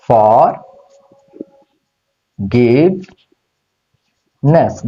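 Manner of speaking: ফরগিভনেস very slowly, long pauses between ফর, গিভ, নেস; split into three syllables. Shown as a demonstration. Teacher revealing the answer to students, ফরগিভনেস in three separated syllables. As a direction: stretch ফরগিভনেস out part by part. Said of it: "তিনটা syllable করেছি।".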